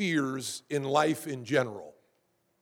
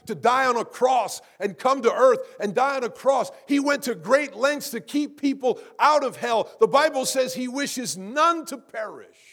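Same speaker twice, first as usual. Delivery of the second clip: raised, ringing pulpit proclamation; impassioned preaching; 6 semitones higher, 5 dB louder than usual. Real, not acted. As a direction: preach it fervently, higher and louder. Preacher at full force.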